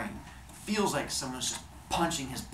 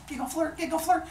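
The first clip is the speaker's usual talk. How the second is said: very high sing-song chirp; mock giggly flirting. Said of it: "they do the giggle flirty thing".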